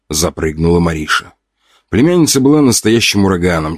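usual speaking voice

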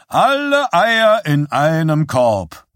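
high, loud, drawn-out showman's cry; calling out. A narrator's reading. Showman to players betting on one strip, calling out. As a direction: sing it out slowly over the crowd. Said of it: "rief der Schausteller".

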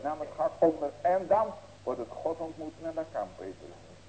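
ordinary talk

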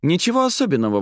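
high-pitched dismissive exclamation; lively, high brush-off; exclaiming. A narrator's reading. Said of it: "воскликнула Арадия".

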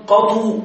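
"قطو" ending on a rounded u after the emphatic t; damma variant. Shown as a demonstration. Teacher listing variants for students, "قطو" ending in u. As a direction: say "قطو" with a clear final u. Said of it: "وفيها لغة بتشديد الطاء مع الضم".

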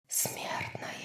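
eerie, drawn-out voiceless whisper; lifeless whisper. A narrator's reading. Lifeless voice whispers in Bryce's head, eerily hushed. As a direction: whisper it like a voice inside someone's head. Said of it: "зашептал в голове Брайс безжизненный".

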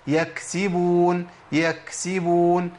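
يكسبون very slow with air flowing through the k; correct breathy k. Shown as a demonstration. Teacher giving a second example word. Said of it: "نجيب كلمة تانية".

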